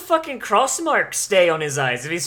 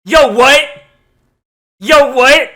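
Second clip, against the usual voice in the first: much higher, much louder shocked yell; shocked shout; 7 semitones higher, 12 dB louder, slower than usual. Real, not acted. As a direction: blurt it out in total shock. Shocked outburst at the scene.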